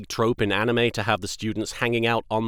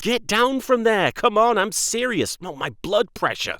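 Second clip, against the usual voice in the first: exasperated teacher; 10 semitones higher than usual. Retold or imitated. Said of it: "part of me is just like".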